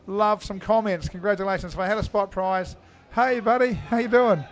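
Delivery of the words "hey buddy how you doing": high, loud hey buddy greeting; bright on-air shout-out; cheery shout-out. Real, not acted. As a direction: call out to a friend watching. Greeting a viewer who commented.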